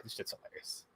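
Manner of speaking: breathy whisper through laughter; whispered aside. Real, not acted. Host laughing to co-host, whispered. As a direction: whisper it, still giggling.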